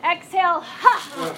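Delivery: sharp ha shouted on the breath out; loud ha exhale. Shown as a demonstration. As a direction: bark the ha loudly like chopping wood. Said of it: "we're gonna go, ha".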